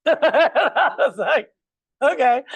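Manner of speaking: a bemused laugh at the client's logic; laughing okay. Real, not acted.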